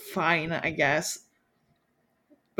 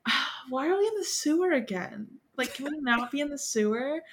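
whiny Lestat; 6 semitones higher, 4 dB quieter than usual. Retold or imitated. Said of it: "And he's like".

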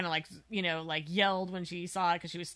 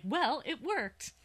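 slow, higher, mock-thrilled; mock excitement; 7 semitones higher, much slower than usual. Teasing the host for sounding excited, slow mock glee. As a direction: drag it out like a gleeful narrator. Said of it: "you seem really excited about this".

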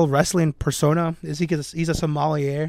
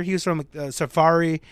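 usual speaking voice

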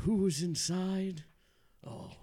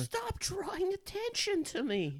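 higher panicky mouse voice; frightened prey animal; 12 semitones higher than usual. Acted. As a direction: beg not to be noticed. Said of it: "talking mouse".

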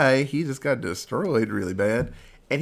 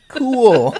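mock cool villain, pitched up and loud; hammed-up nonchalant villain; curt villain; 8 semitones higher, 9 dB louder than usual. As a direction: toss off a cocky one-word reply. Villain's curt reply in retelling.

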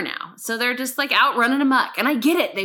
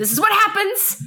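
loud, raised-pitch telling-off; pissy; 9 semitones higher, 4 dB louder than usual. Retold or imitated. Neighbour telling off kids over the ball, pissy. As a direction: scold them with an I-told-you-so edge. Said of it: "But I'm pissy".

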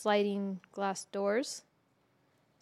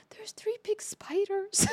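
slow, breathless whisper, higher; 10 semitones higher, slower than usual. Retold or imitated. Waking her sick mom about the spiders, slow frightened whisper. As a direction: whisper so you won't startle a sick mom. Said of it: "And I wake her up all gently".

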